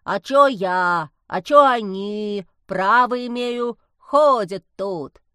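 slow, loud croaky whine; croaking kikimora. A narrator's reading. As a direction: protest like a swamp creature, dragging every word. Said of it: "проквакала кикимора". (квакающая кикимора оправдывается)

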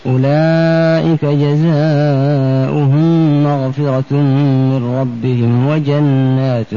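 normal talking voice

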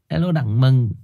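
ordinary delivery

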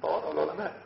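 usual speaking voice

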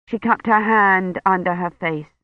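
plain reading voice

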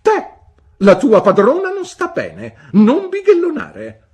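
high, loud order; energetic command. A narrator's reading. Aunt Betsy ordering the servant, loud and commanding. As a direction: bark it like the mistress of the house. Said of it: "Avendo lanciato il comando con tanta energia".

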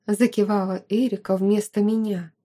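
usual speaking voice

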